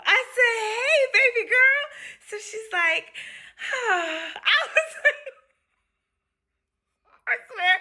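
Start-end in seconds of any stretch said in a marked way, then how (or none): 0.7-1.8 very high, loud cooing; acted baby-talk excitement; gushing greeting. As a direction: squeal a slow, loving hello. Acts affectionate greeting to patient.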